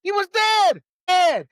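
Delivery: loud indignant shout, real anger; outraged shout. Real, not acted. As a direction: shout it in disbelief. Outraged point about the date.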